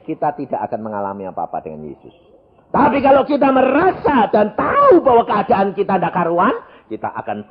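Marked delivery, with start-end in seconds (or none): 2.7-6.5 full-force preaching. Acted. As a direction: preach it out over the congregation. Preaching point.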